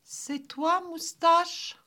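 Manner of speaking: put-on high little-girl voice; high, searching childlike call; small voice. A narrator's reading. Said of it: "demanda la petite voix".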